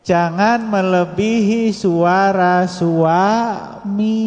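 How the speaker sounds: very slow, each word weighed; slow emphatic warning. Acted. Teacher warns the wives about their voices, slow and weighty. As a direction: drag it out so the point sinks in.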